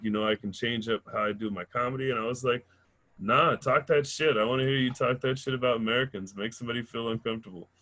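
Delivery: ordinary talk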